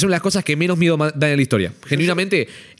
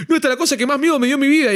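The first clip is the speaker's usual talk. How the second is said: high, breathless mock-scared quote; mock scared creator; 10 semitones higher, faster than usual. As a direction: imitate someone claiming it's the scariest thing ever.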